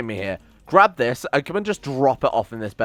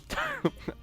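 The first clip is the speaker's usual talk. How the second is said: short high-pitched disbelieving laugh; squeaky laugh; 11 dB quieter than usual. Real, not acted. Streamer cracks up at his colonist's blunder, high laugh. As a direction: let out a squeaky giggle of disbelief.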